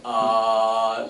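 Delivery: "ah" loud and long like at the dentist; open ah sound. Shown as a demonstration. Teacher showing her ah sound with an action. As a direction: open wide and say ah. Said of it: "show Dave's wife your teeth".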